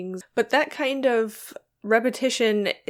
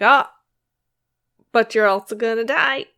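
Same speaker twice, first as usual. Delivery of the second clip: mock poet's doom; 2 semitones higher, 7 dB louder than usual. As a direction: blurt it out like a gloomy poet, hammed-up. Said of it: "without being like".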